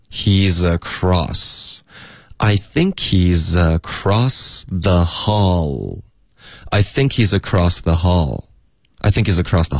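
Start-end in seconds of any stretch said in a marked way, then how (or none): 0.1-1.8 slow, he's flowing straight into across; slow linked demo. Shown as a demonstration. Teacher modelling the link for learners, slow. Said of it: "要连读". 2.4-6.0 slow, every link in the sentence drawn out; slow practice read. Shown as a demonstration. Teacher's slow first model for students, drawn out. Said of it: "就是这么读".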